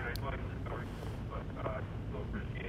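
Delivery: faint, far from the phone; speaking away from the speakerphone; phone held away. Real, not acted. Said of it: "You can't hear us".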